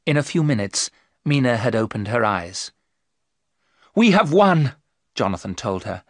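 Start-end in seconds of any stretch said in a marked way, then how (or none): 3.9-4.7 high, loud, triumphant cry. Acted. Jonathan telling Mina they have won, triumphant. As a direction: announce the victory with joy.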